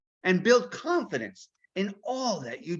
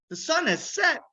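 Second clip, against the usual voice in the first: ordinary delivery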